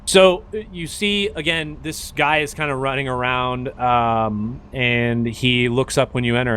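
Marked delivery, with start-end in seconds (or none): none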